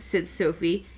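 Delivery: ordinary delivery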